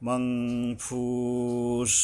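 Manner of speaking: মাংফুস slow, humming the ng, long u; nasal ng. Shown as a demonstration. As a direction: hold the মাং of মাংফুস as a nasal hum. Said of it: "অনুস্বার যোগে হবে". (মাংফুস অনুস্বার যোগে টেনে পড়া)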